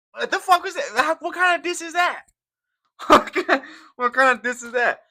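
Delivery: high, disbelieving squeal; can't believe this diss; incredulous. Real, not acted. Reacting to a fighter's tweet, high incredulous disbelief.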